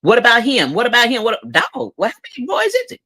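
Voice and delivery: high, fast mocking mother; mock mama. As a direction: imitate the nagging mama. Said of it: "her mama talking about".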